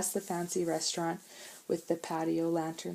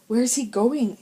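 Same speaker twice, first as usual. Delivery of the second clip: alarmed question; 6 semitones higher, 7 dB louder than usual. A narrator's reading. Boys stranded on drifting ice.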